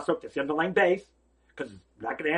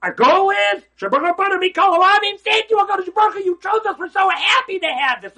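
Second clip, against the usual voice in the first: loud, high, elated cry of thanks; a thrilled shout thanking God for being chosen; joyful thanks; 14 semitones higher, 9 dB louder than usual. Acted. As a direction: burst out with overjoyed gratitude. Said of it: "joyful blessing voiced as thanks".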